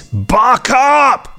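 shout. Acted. Jingle shout between listener emails.

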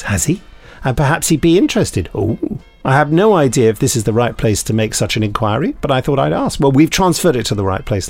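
ordinary talk, nothing marked

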